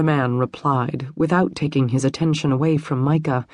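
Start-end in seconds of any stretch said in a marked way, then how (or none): none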